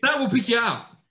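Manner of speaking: a touch of a hammed-up eager friend; eager Goose. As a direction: chirp it like the overeager mate. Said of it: "Goose goes".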